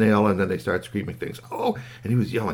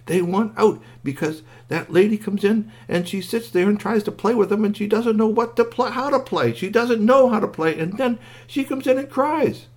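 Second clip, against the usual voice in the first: high, insistent, emphatic outpour; distressed squeaky toy; 12 semitones higher than usual. A narrator's reading. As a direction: plead the toys' case in a squeaky voice. Squeaky goes on explaining.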